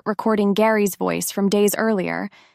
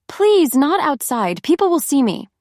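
pleading; 6 semitones higher than usual. A narrator's reading. Missy begging in fear.

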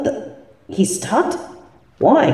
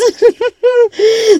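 mocking laugh; 11 semitones higher than usual. Villain laughs at broken game.

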